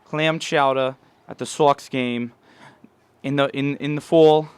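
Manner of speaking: loud Boston 'chowdah' voice; Boston accent. Retold or imitated. Bostonian praising clam chowder, in a loud Boston accent. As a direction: savour it like a Boston local. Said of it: "that was our resident Bostonian".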